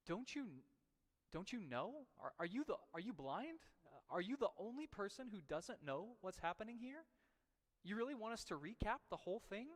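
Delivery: annoyed disbelief at the stranger; aggravated. Retold or imitated. Cleopas snapping at the stranger, exasperated. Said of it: "almost aggravatedly says".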